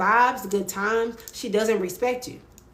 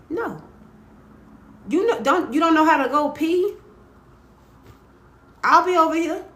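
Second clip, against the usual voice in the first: sassy refusal; 7 semitones higher than usual. Retold or imitated. Refusing friend, pitch up.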